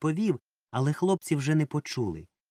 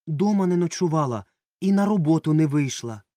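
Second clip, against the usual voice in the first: louder, urgent exclamation; exclaimed; 5 semitones higher, 6 dB louder than usual. A narrator's reading. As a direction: cry it out, interrupting. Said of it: "вигукнули".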